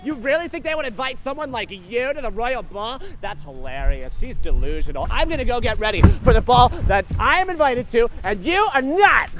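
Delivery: loud, mocking bully; sneering stepsister. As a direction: jeer like a cruel sister. Stepsister scolds Cinderella.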